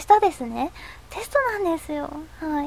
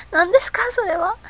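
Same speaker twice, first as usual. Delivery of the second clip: higher, louder what-is-that; incredulous; 6 semitones higher, 6 dB louder than usual. Real, not acted. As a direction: react in disbelief. Reacting to a strange comment.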